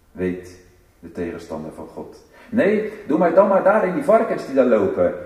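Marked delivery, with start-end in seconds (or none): 2.5-5.1 high, loud pleading demon voice; loud, raised voice of a desperate demon; demon begging. Acted. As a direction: beg like a cornered demon.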